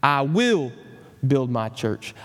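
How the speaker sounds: "will" swelling up, the rest dropping away; stress on will. Retold or imitated. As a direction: lean hard on the word "will". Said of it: "emphasize a different word of that promise".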